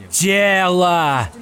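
high, loud full-lung yell; yelling at the top of his lungs. A narrator's reading. Ed yells at the top of his lungs pushing through the crowd. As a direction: scream it with all your breath. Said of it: "заорал Эд на пределе лёгких".

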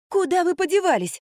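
high, indignant outcry; exclamation. A narrator's reading. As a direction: exclaim as you demand an explanation. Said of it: "воскликнула я". (возмущённый высокий возглас)

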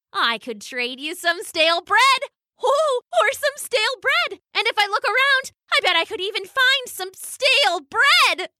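high, fast, giddy duck voice; chirpy duck. A narrator's reading. The duck offers Bailey stale bread in a high giddy babble. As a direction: babble like a silly duck pleased with her joke.

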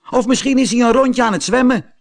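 put-on high, fast teasing boy voice; a boy teasing Pinocchio about the missing whale; jeering schoolboy. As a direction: rattle off the joke cheekily.

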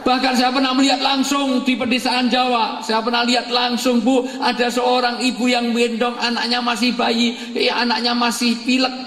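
high, ringing sermon voice; preacher rousing the congregation; preaching at full force. Real, not acted. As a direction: preach it out to the hall.

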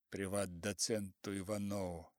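ordinary delivery

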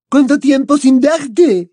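put-on slow, high, loud French-accented gush; a delighted French girl.

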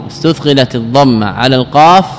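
ordinary talk

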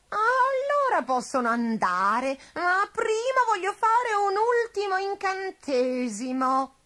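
slow, drawn-out witch voice, climbing high; insistent witch. A narrator's reading. Witch agreeing on one last spell, insistent. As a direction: insist slowly and grandly. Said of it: "insisté".

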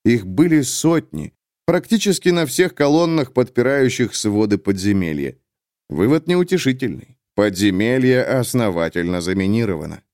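ordinary delivery

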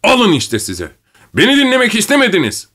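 high-pitched furious outburst; angry yell that shoots upward; yelling at full voice. A narrator's reading. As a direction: cry it out, leaping high in pitch. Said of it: "avazı çıktığı kadar bağırdı".

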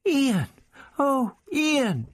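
high, joyful cry of recognition; overjoyed girl seeing her friend; delighted. Acted. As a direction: greet him like a rescued friend. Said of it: "awoke to a delighted smile".

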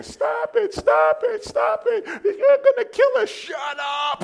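high frantic shouts, half-laughing; terrified partner begging the driver to stop; panicked yelling. Retold or imitated. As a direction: shriek at the driver in terror. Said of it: "John is saying".

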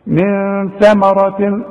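very slow من ثمرة with a light ثاء, not صا. Shown as a demonstration. Teacher correcting his own heavy ثاء, slow. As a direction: draw out ثمرة gently. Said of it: "شوف".

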